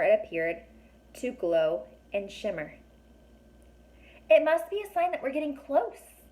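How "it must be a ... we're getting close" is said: happy. A narrator's reading. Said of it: "Alex said, happily".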